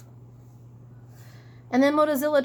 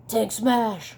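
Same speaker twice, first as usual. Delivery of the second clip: sleepy brute; 4 dB louder than usual. Acted. Tank talks in his sleep.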